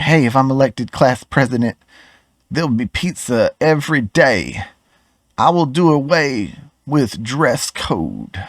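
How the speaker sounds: a lightly voiced class-president hopeful; mock campaign promise. Vlogger mocking vote-chasing politicians as an eager schoolkid, lightly hammed up. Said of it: "It's like".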